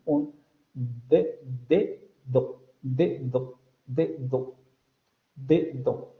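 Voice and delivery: de, do each with a hard explosive d; stop-by-stop dedo. Shown as a demonstration. Teacher mimicking an English speaker's dedo. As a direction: say both d's of dedo as full stops. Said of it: "pero sería algo así".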